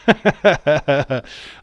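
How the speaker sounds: big high burst of laughter; loud, high-pitched cackling laugh. Real, not acted. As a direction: crack up at your own joke. Laughs at own racist joke.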